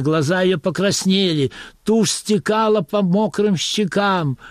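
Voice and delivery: ordinary delivery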